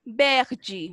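"verde" said VEHR-dji; final DE as "dji". Shown as a demonstration. Teacher modelling the color "verde" for pupils, ending in "dji". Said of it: "lo vamos a pronunciar como G".

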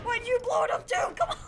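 high, loud laughing yell; incredulous laughing shout. Real, not acted. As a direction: laugh it out at a teammate's blunder. Teammate blew up the generator.

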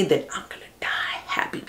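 hushed, dreamy whisper; whispered devotion. Pretend. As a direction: breathe it like a fatal vow. Voicing Alma's devotion, hushed.

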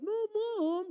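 put-on high whining child's protest; whiny kid. Kid whining back at mom when told what to do. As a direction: talk back like a sulky kid.

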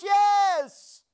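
high, loud shout; a big congregational yes. Shown as a demonstration. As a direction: shout it out to the church. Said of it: "Somebody shout".

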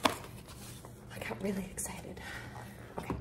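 sheepish whisper. Real, not acted. Apologising to viewers for getting carried away, whispering. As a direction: whisper it, a bit embarrassed. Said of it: "I'm sorry".

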